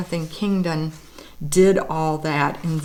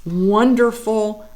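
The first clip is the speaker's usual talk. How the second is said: usual speaking voice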